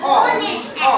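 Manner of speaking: normal talking voice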